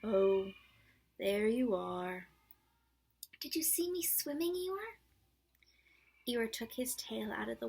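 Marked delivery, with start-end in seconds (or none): 0.0-2.3 slow, lightly gloomy donkey voice; gloomy Eeyore. Acted. Eeyore answers Roo. 3.4-5.0 much higher, louder little-kangaroo squeak; squeaky Roo. Acted. Roo boasting.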